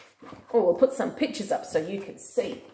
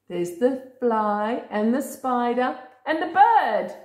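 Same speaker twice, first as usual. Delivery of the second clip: usual speaking voice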